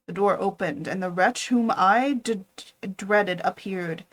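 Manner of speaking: usual speaking voice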